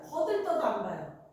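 normal talking voice

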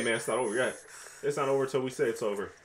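ordinary talk

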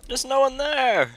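very high slow alarmed cry; spooked cry. Real, not acted. Door opened by itself.

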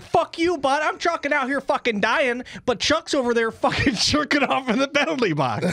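hammed-up high-pitched gripe, breaking into laughter; mock whiny player. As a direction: whine it like a worn-out player stuck on the ice. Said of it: "Now I'm like".

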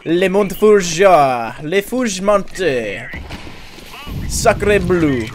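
light mock French accent; french chef. Fake French naming.

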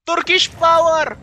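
high loud victory cry; triumphant shout. Real, not acted. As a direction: cheer it out. Triumphant cheer.

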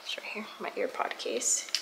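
soft unvoiced whisper. Real, not acted. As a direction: breathe the words softly. Whispering near the camera.

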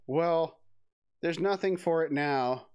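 usual speaking voice

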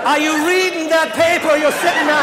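high, loud outraged echo of the question; incredulous repeat. Retold or imitated. Comic echoing stranger's dumb question, high loud disbelief.

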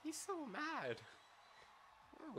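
high-pitched squeaky voice. Real, not acted. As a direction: squeak it like a cartoon. Mocking squeaky voice.

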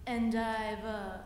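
low, soft coaxing spider voice; sly spider. Acted. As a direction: purr it low and sweet like a spider. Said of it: "said the spider to the fly".

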